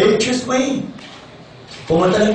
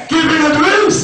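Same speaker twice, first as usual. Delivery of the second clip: full of energy; 8 semitones higher than usual. Shown as a demonstration. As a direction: blast the motto out with full energy. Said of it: "So, dapat full of energy".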